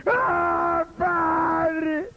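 high crying wail to God; theatrical sobbing plea; mock wailing prayer. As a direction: ham up an overwrought prayer. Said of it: "¿Han visto esas oraciones?".

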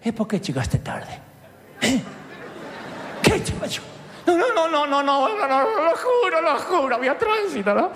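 mock Italian boss then high, flustered pleading; Italian boss and excuses. Italian boss scolds him for arriving late, mock accent. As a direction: grill the late employee, then beg no no no. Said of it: "jefe italiano que me dice".

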